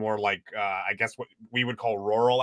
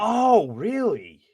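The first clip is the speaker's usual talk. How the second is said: surprised; 10 semitones higher, 4 dB louder, much slower than usual. Real, not acted. Host reveals actor plays creatures.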